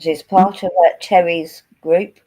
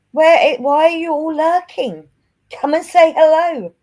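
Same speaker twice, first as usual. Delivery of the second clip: calling out; 9 semitones higher, 5 dB louder than usual. Real, not acted. Calling out to lurking viewers.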